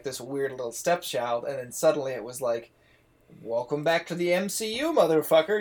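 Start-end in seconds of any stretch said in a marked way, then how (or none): 3.4-5.6 higher, brash taunting voice with a loud swear; cocky mock taunt. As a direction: swagger like a show daring you to keep up. Acted cocky welcome back to MCU.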